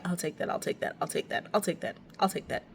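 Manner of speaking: soft, hushed rapid-fire acceptance; quiet mock resignation; resigned muttering. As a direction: mutter it under your breath, repeating.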